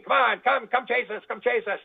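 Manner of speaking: light sing-song alien taunt. Retold or imitated. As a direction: egg the jets on like a playground dare. Aliens voiced taunting the jets.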